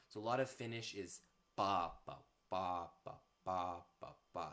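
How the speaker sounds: ba ba chanted with a strong hit on the first ba of each pair; meter rhythm demo. Shown as a demonstration. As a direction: chant "ba, ba" as a trochaic beat, stressing the first. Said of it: "you have the accent on the first syllable".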